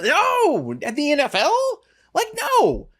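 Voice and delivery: high, exasperated no; emphatic no. Real, not acted. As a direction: shoot it down hard, pitch jumping up. Emphatic rebuttal of fan logic.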